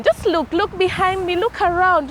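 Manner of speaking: normal talking voice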